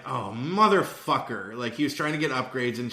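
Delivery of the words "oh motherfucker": slow, knowing groan of a curse; slow drawn-out realization. Retold or imitated. Son catching on to dad's upgrade trick, slow and drawn out. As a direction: draw it out slowly as the penny drops. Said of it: "And I'm like".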